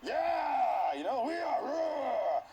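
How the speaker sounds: loud, high eager yell from above; eager servants shouting up. Retold or imitated. Servants in the window shouting to Jehu, loud and eager. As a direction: call it down like keen servants. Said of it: "They're like".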